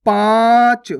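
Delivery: पाँच with a long nasalised vowel. Shown as a demonstration. Teacher modelling for learners, loud drawn-out nasal पाँच. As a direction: stretch पाँच loudly through nose and mouth. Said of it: "इसी प्रकार से आपको इसका उच्चारण करना है".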